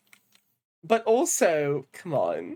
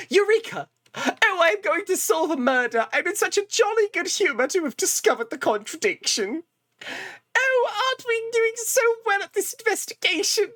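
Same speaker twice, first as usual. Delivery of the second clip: high, prissy jolly gentleman voice; foppish Edgeworth impression; 13 semitones higher than usual. Streamer imitating Edgeworth's jolly foppish voice. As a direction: play a delighted dandy in a cravat. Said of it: "this is how he fucking sounds".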